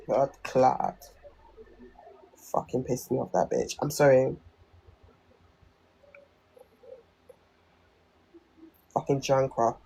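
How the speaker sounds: low muttering. Real, not acted. Cursing to self after coughing, muttered irritably. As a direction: mutter it under your breath.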